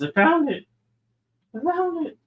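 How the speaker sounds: high squeal of relief; relieved cry. Real, not acted. Streamer finding the dropped toy, squealing high. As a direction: cry out in triumph.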